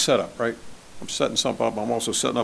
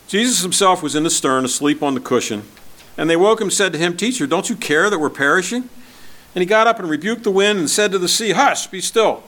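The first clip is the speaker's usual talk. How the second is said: louder, raised scripture reading; 7 semitones higher, 6 dB louder than usual. Acted. Preacher reading the storm passage to his church, raised reading voice. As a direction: read the Gospel out to the congregation.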